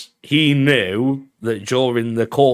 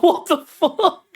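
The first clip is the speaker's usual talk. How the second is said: high, drawn-out disbelief; shocked reaction; 14 semitones higher, slower than usual. Retold or imitated. Viewer reacting to the band leader's crude insult, shocked. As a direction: squeal it in laughing incredulity.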